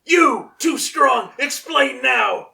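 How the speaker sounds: high, loud, barked demands; Vegeta voice. Retold or imitated. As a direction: bark it like an angry prince. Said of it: "what's a Vegeta line?".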